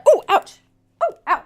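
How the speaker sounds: high little yelps of pain; mock pained exclamations; acted aches. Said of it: "and now I'm like".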